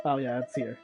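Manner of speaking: normal talking voice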